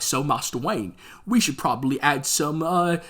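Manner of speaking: badly faked British butler; mock-British butler making a polite suggestion; terrible British accent. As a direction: put on a hammy British accent. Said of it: "that was a terrible accent".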